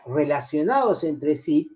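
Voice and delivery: ordinary talk, nothing marked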